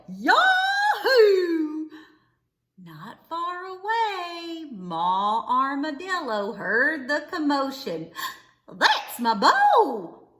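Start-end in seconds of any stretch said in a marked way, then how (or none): 0.1-1.9 loud, high whooping yell; a gleeful yee-haw shouted from the hayloft; rebel yell. A narrator's reading. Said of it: "He let out a rebel yell". 8.7-10.3 loud, slow proud call; a mother's proud shout hearing her son; proud cry. A narrator's reading. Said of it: "she cried".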